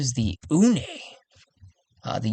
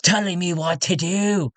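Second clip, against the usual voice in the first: higher-pitched character voice, full of business; tactless councilman; 4 semitones higher than usual. Acted. Tradesman telling meddlers he has business to attend, tactless character voice. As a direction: play the blunt tradesman, pitched up. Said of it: "he's like".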